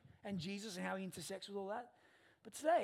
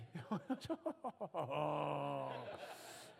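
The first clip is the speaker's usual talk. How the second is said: real laugh; 3 semitones lower than usual. Real, not acted. Laughing at his son's line.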